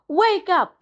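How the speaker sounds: sharp high bark of a call. A narrator's reading. Young seal barks at the sleeping walrus. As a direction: bark it out over the noisy gulls. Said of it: "barked Kotick".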